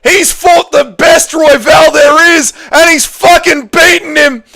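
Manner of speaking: high, loud, racing fight call that keeps climbing; excited play-by-play. Acted. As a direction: call the final round like it's the fight of your life. Live play-by-play of fight finish.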